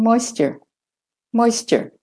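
"moisture" with American r ending, high then falling; American er closing "moisture". Shown as a demonstration. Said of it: "And American".

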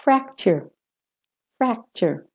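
"fracture" with American r ending, high then falling; American er closing "fracture". Shown as a demonstration. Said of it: "British and Australian first".

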